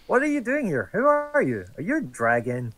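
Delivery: high-pitched, pointed questions; high suspicious questioning. Acted. Player's character confronting the strange woman, high and suspicious. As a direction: grill her up high, suspicious. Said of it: "And I'll be like".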